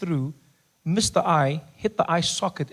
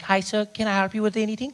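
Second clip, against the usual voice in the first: lightly voiced counter assistant; a hint of polite customer service; pharmacy woman; 6 semitones higher than usual. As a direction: imitate a helpful woman behind the counter. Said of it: "The woman's behind the counter".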